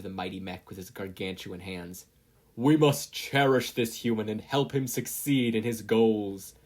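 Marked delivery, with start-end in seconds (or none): 2.5-6.5 loud, booming machine voice; booming robotic voice. A narrator's reading. Zero-pointer addresses the other robots, booming robotic voice. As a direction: boom it out like a giant robot. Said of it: "its booming robotic voice".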